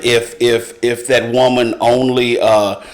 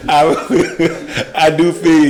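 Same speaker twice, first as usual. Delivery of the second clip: big laugh breaking up the words, higher pitch; cracking up; 5 semitones higher, much slower than usual. Real, not acted. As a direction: try to talk while laughing hard. Cracks up at Tarzan quip.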